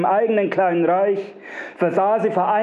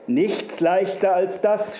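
usual speaking voice

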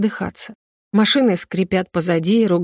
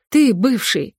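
mildly raised angry retort; crying out; 3 semitones higher, slower than usual. A narrator's reading. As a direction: throw the accusation back at him. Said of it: "выкрикиваю я".

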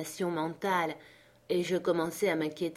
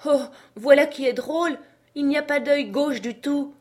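high, loud character voice; baffled servant; 8 semitones higher, 7 dB louder than usual. Acted. Jupiter finds no left eye, baffled. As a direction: exclaim in puzzlement.

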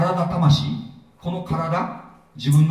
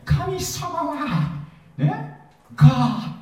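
very high, very slow hammed-up roar of a god; mock God voice; 12 semitones higher, much slower than usual. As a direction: ham up a roaring, booming deity with a laugh. Mocking the old image of God. (笑いながら大げさに吠える神様の真似)

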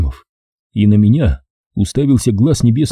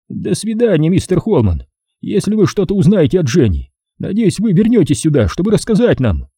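put-on higher polite young man; polite young man, voiced higher; 8 semitones higher than usual. Edgar voice.

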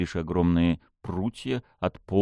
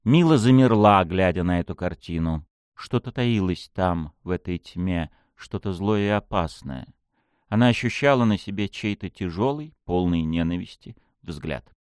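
usual speaking voice

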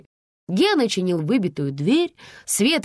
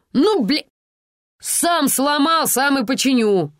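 high, slow, blustering promise; burly guy's declaration; 7 semitones higher, 3 dB louder, slower than usual. Acted. Burly neighbour announces he'll fix the door, blustering. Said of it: "заявил дородный парень".

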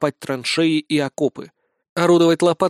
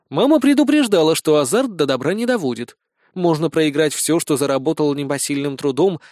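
usual speaking voice

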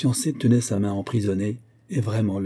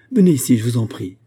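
urgent plea; 3 semitones higher, 7 dB louder than usual. A narrator's reading. Ladov begs Ariane to come back.

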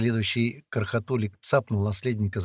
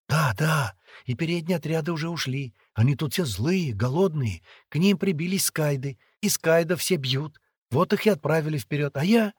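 put-on higher, lively girl's voice; chattering young girl; 6 semitones higher, 4 dB louder than usual. A narrator's reading. Girl telling captive boy of ransom, chattering. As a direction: reassure him in a pitched-up chatter.

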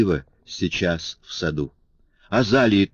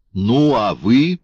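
slow, raised, louder reproach held in check; angry yet respectful; 4 semitones higher, 5 dB louder, slower than usual. A narrator's reading. Marius reproaching Valjean, angry yet respectful. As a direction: scold him but keep the respect. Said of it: "вскричал Мариус гневно и вместе с тем почтительно".

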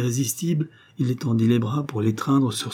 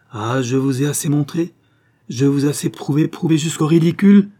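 rising passion; 5 semitones higher, 6 dB louder than usual. A narrator's reading. Passionate declaration.

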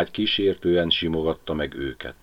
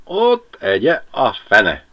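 heated curse; 5 semitones higher, 6 dB louder, slower than usual. Acted. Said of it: "felfűtött állapotban".

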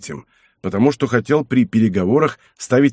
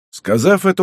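usual speaking voice

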